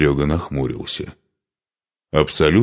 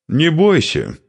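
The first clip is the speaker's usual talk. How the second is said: confidently reassuring, pitched higher; 12 semitones higher, 3 dB louder, slower than usual. A narrator's reading. Young warrior telling his leader not to fear, confident. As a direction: brush off the worry with assurance. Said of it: "уверенно произнёс Машек".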